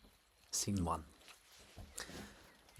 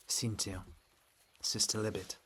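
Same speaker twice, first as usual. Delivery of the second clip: normal talking voice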